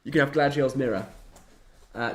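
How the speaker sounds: ordinary delivery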